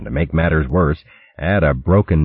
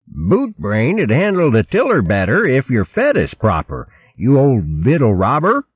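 higher, rough insolent sailor; jeering crewman; 8 semitones higher than usual. Acted. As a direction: heckle the captain from below. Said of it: "Another insult rang out".

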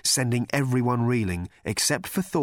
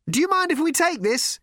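high-pitched shouted request; projected high voice; calling out; 4 dB louder than usual. A narrator's reading. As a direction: call it out cheekily. Ford calling over the wreckage.

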